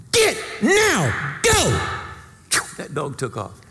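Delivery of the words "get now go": stamp your foot and order it off; sharp command. Retold or imitated. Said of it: "She stomped her foot and said".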